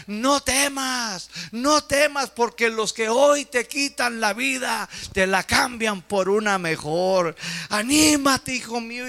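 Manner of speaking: high, loud, pleading encouragement; mother shouting. Retold or imitated. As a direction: call out across the bars, urging him on. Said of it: "le gritaba la madre". (madre gritando con ánimo)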